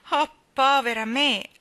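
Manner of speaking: slow, loud woe-is-me cry; self-pitying lament. Acted. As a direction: draw out the self-pity. Child pities herself, left out.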